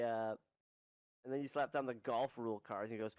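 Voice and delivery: usual speaking voice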